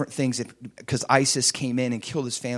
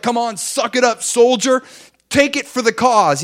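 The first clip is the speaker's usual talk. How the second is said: loud, higher barking drill sergeant; 9 semitones higher, 7 dB louder than usual. Pretend. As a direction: bark it like a tough sergeant yelling at a recruit. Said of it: "he doesn't look at us as like".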